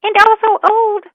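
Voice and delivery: squeaky high mouse voice; tiny mouse piping up loudly; little mouse. Acted. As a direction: chime in loudly at very high pitch. Mouse chimes in.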